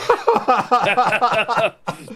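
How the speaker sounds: loud, high burst of laughter; real, unrestrained laughing fit; delighted laugh. Real, not acted. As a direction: laugh out loud at a clever reveal. Reaction to the clever reveal.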